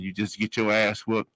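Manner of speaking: ordinary talk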